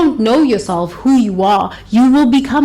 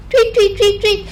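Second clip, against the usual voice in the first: piping bird tweets, much higher; high chirping tweets; 11 semitones higher than usual. Retold or imitated. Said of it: "wants you to dance like this".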